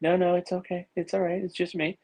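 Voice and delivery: calm him down as a chummy president; imitating Trump. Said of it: "And he's like".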